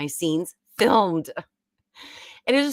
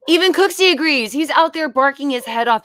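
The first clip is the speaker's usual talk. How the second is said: louder, higher playful cry; excited exclamation; 8 semitones higher, 8 dB louder than usual. Real, not acted. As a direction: announce it with glee.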